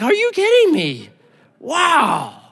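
high, astonished are you kidding me. Pretend. Someone told they are royal heir, astonished. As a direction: let the amazement burst out.

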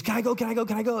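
high, rapid-fire pestering; eager spaz. Retold or imitated. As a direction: beg like an overexcited kid, without a gap. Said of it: "he's kind of a spaz".

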